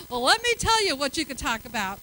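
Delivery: normal talking voice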